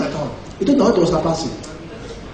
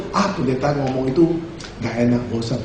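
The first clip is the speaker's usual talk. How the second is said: bored complaint; 11 semitones lower, 5 dB quieter than usual. Retold or imitated. Acts friend bored with preaching.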